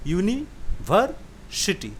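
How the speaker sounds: "यूनिवर्सिटी" slowly, with pauses: यूनि, वर, सिटी; split into syllables. Shown as a demonstration. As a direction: say "यूनिवर्सिटी" as three separate chunks, यूनि, वर, सिटी. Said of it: "तीन भाग में बटा गया". ("यूनिवर्सिटी" को तीन भाग में तोड़कर, रुक-रुक कर: यूनि, वर, सिटी)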